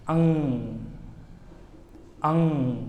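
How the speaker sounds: अंग ending on nasal ng with no ga; correct ng sound. Shown as a demonstration. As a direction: model अंग cleanly. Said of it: "अब हम इसका उच्चारण करेंगे, आप ध्यान से देखिएगा".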